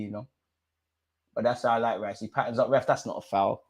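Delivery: usual speaking voice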